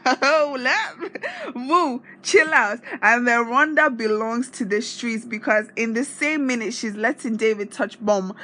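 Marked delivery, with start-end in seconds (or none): 0.0-2.8 high, loud, slowing down at the end; mock scandalized. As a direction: pretend to fan yourself at a bold move. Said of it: "And you're like".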